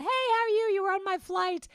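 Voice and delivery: high-pitched, loud, delighted hail; excited greeting. Retold or imitated. Passenger spotting someone from the same flight, excited greeting. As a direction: squeal hello across the baggage claim.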